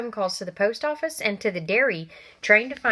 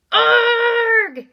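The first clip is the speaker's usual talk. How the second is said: loud, high startled yelp; sudden high scream of fright; startled cry; 9 dB louder than usual. Acted. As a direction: yelp as if stepping on something alive. Harry steps on something squishy.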